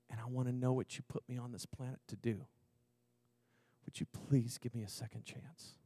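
soft, low, halting plea with long pauses; hushed prayer. Retold or imitated. Man pleading with Jesus for a second chance, hushed prayer. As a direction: pray quietly, voice dropped low. Retelling his desperate prayer.

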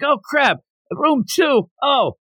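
high-pitched mock dismay; dismayed realization. Bakugo sees he took the wrong room, mock dismay.

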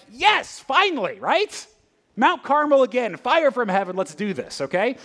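high, loud, thrilled yes; pumped for another big miracle; eager Elijah. Retold or imitated. Elijah cheering God's plan on, high and excited. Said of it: "Elijah's like".

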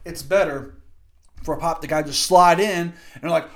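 ordinary talk, nothing marked

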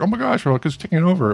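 high-pitched mock alarm; mock outrage. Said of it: "kind of outraged".

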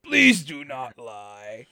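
loud, drawn-out dramatic proclamation; the Riddler. Retold or imitated. Co-host quoting the movie villain to a friend, slow overdramatic villain voice. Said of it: "He's like".

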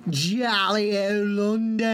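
put-on joking British accent about London; mock British accent. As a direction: ham up a jolly British accent. Jokes about the cohost's London trip.

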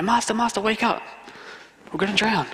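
high, urgent plea to wake up; like a frightened fisherman shaking him awake; panicked disciple. Retold or imitated.

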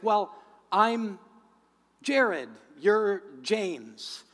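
loud, very slow spelling-out; emphatic clarifying. Retold or imitated. Victim explains to the mugger who is who, emphatic clarifying. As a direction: stretch each name loudly to make it clear.

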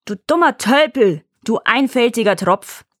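high, loud furious yell; angry roar. Acted. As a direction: bellow the insults in rage. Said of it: "brüllte der enttäuschte Teufel zornig".

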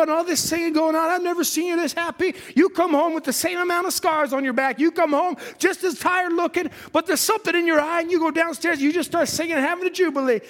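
wife prodding her husband about his joy; curious wife. Acted. Wife questioning Amram in story.

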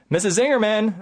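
high, loud call of her name; shout. Retold or imitated. Nervous student shouting at his first patient. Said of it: "I shout".

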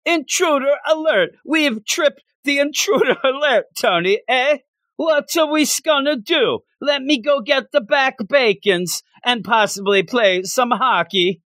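high, hammy Canadian voice; Canadian accent. Retold or imitated. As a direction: play the goofy Canadian alarm. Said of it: "That's how I think everybody in Canada talks".

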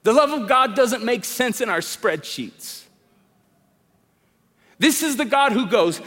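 normal talking voice

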